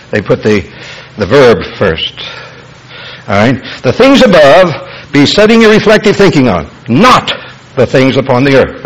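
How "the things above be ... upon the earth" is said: emphatic teaching, "things above" lifted to the front; stress on THINGS ABOVE. Shown as a demonstration. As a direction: drive home "things above" and "not", easing off on "the earth". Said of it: "the emphasis".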